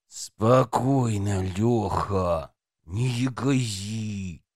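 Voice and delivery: slow, low, forced through teeth; through gritted teeth. A narrator's reading. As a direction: grind the words out slowly. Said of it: "Сквозь зубы процедил подрывник".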